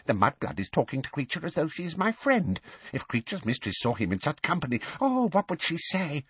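fast, high-pitched indignant elf; scandalised elf, racing along under his breath; furious muttering. A narrator's reading. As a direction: mutter rapidly in scandalised outrage. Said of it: "he muttered faster and more furiously".

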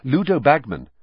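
ordinary delivery, nothing marked

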